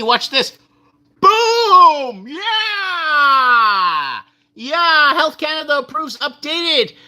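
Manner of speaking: mock cheering. Mock cheering at Canadian news.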